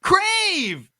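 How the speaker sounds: high, loud echo shout; excited shout. Acted. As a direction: shout the name like a cheer. Co-host echoes sponsor name.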